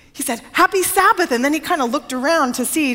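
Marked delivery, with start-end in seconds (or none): 0.5-1.3 high-pitched eager greeting; lightly mimicked, higher-pitched hello; hearty greeting. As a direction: imitate a visitor's cheery hello. Retells the mayor's greeting.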